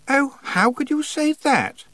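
very high, drawn-out dismay; appalled reproach. A narrator's reading. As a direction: reproach a friend in an affected high voice. Anagramma scolds Tiffany in dismay.

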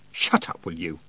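low, curt snap; snapped. A narrator's reading. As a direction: cut them off with restrained irritation. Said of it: "snapped Tiffany".

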